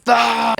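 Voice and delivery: short, high mock scream; imitated scream. Retelling a sleeper's sudden night scream, mock scream. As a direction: imitate a sudden cry, held back in volume. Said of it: "he just screamed".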